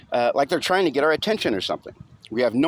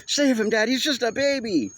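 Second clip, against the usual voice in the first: put-on high boy voice, crying out a plea; tearful plea; 12 semitones higher than usual. A narrator's reading. Boy begs his father to save the baby, tearful. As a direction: beg through tears like a little kid. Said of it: "tears streaming down my face".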